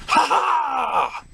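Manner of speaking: high hammed-up villain laugh; mock laugh. Reviewer signs off with a hammed-up laugh. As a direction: cackle like a trickster.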